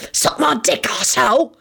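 high, tense hissed insult; hissing. A narrator's reading. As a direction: hiss it at him, pitched high. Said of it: "Sirius hissed".

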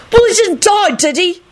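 very high, contained yell; yelling back. A narrator's reading. Alex arguing back at Clive, yelling. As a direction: shoot it back in a high, irritated cry. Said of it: "Alex yelled back".

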